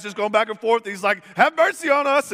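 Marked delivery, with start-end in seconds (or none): 1.4-2.3 high, very loud mock plea; hammed-up desperate shriek; shrieked begging. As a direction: wail for mercy at full voice. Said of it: "he's begging, and he's shrieking".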